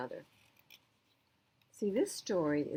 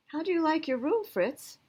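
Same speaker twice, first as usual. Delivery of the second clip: put-on higher, bright child question; lightly voiced eager boy; 10 semitones higher, 5 dB louder than usual. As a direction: ask it like a proud boy showing his room. Boy shows dog his room.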